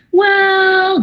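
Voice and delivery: high, drawn-out "well"; hesitant stalling. Acted. Recruit dodging a direct question, hesitant and high. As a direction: imitate someone stalling before an awkward answer. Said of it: "Some people even stutter over words".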